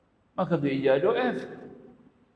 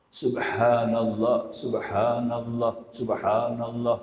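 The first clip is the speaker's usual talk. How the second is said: slow, drawn out chant; slow careful dhikr; slow recitation; 3 semitones lower, much slower than usual. Shown as a demonstration. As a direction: recite each word slowly. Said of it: "recitation demo".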